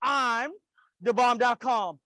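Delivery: rallying call-and-response affirmation; emphatic, phrase-by-phrase declaration for repeating; like you mean it. Shown as a demonstration. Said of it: "you could do better than that".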